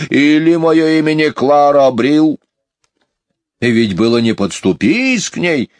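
put-on high, grumbling old-fashioned fussing; indignant housekeeper. Housekeeper grumbles about the departed nanny, high and indignant. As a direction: complain with feeling while bossing the children.

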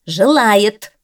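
an annoyed cat contradicting his friend; angry insistence. A narrator's reading. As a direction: snap it back in irritation. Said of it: "вновь рассердился Белый".

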